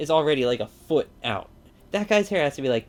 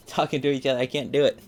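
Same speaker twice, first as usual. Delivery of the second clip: normal talking voice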